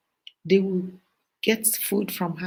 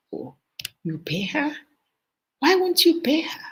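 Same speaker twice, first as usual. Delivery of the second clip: higher, mocking jeer at the payer; mocking boast; 9 semitones higher than usual. Boastful students teasing a paying classmate, higher and mocking. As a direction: scoff at someone who pays. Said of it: "people are actually boastful of it".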